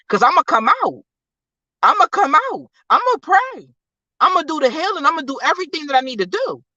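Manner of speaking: high-pitched, rising preacher's declaration; impassioned preaching. Real, not acted. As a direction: declare it with mounting conviction.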